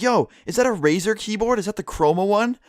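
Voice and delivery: put-on high, eager teen voice; eager kid. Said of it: "He'd be like".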